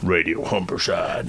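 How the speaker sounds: slow American radio voice; American accent. Shown as a demonstration. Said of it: "Oh, why did I go American?".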